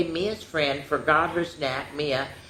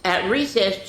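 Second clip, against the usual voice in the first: ordinary delivery, nothing marked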